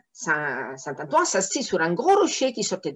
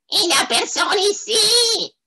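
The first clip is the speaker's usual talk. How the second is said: much higher, piercing malicious voice; shrill spiteful devil; 14 semitones higher, 3 dB louder than usual. Acted. Second devil shrieks at the saint, shrill and spiteful. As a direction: screech it like a nasty little devil. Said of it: "une autre voix stridente et malveillante".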